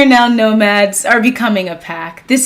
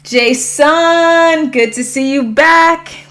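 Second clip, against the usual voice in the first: high, hyped-up shout-out; excited greeting; 6 semitones higher, slower than usual. Real, not acted. Streamer welcoming Jason back to the chat, excited. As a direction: call out happily, pitch lifted.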